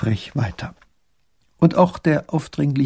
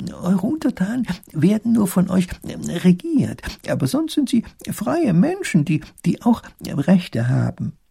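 earnest professor; 7 semitones higher than usual. Acted. Professor explaining rights to the duke, patient.

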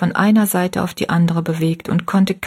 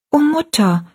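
put-on high, pleading girl's cry; a frightened daughter pushing back; girl protesting; 9 semitones higher than usual. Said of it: "widersprach ihr Rosalie".

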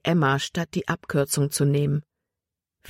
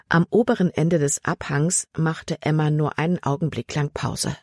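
ordinary delivery, nothing marked

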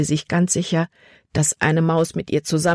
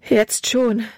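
relieved; 6 semitones higher than usual. Acted. Libby telling Caleb she is fine now: relieved. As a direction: answer with a burst of relief. Relieved answer after fright.

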